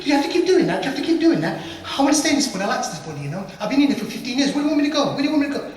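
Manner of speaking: high, loud, insistent spirit; pleading jinn. Acted. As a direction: plead like a jinn refusing eviction. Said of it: "They're talking like this".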